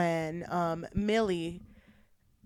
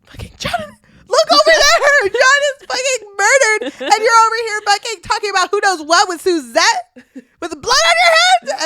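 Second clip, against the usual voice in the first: high, loud mock shriek through laughter; hammed-up screaming wife; 13 semitones higher, 13 dB louder than usual. Wife yelling at husband, high mock-hysterical laughing shriek. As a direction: scream at him, half laughing. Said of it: "It's like".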